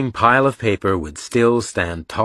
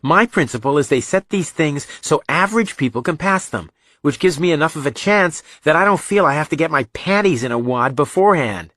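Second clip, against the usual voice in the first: put-on higher, animated young voice; Reacher, argumentative; 8 semitones higher, 4 dB louder than usual. A narrator's reading. Reacher explaining his principle to Joe, heated argument. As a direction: argue the case with exasperated energy.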